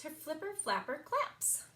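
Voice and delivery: plain reading voice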